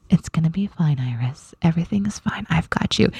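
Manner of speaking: put-on whispered calm for a frightened child; mother whispering to her scared daughter in a dark theater; soothing whisper. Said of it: "I'm saying this".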